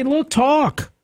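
mock order. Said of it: "I'm just trying to say".

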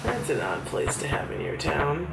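usual speaking voice

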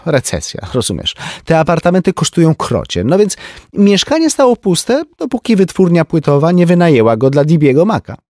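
high, lively society lady; a chatty rich woman gossiping; Tenzi's voice. A narrator's reading. (wysoki głos plotkującej damy)

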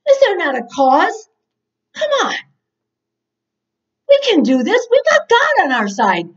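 put-on high, earnest young-hero plea; eager rallying. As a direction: urge them on with bold confidence. Acting David rallying his brothers.

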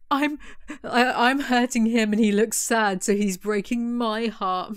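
slow, overwrought parody; mock melodrama. Reviewer parodying Zoe's self-pity, slow mock melodrama. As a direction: draw it out with fake drama. Mocking the narrator's line.

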